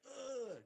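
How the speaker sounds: high, loud "ugh"; strained grunt as a body folds; imitated impact groan. Telling how his son landed scorpion-style, pained grunt.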